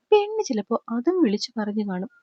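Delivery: ordinary delivery, nothing marked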